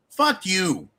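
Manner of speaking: ordinary talk, nothing marked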